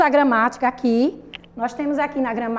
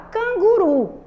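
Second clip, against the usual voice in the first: "Canguru" raised high, with the final RU stretched; higher, emphatic "Canguru" to show the final stress; drawn-out stressed word; 7 semitones higher, 4 dB louder, slower than usual. Shown as a demonstration. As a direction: sound out "Canguru" emphatically, leaning on RU. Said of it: "Qual é a sílaba mais forte?".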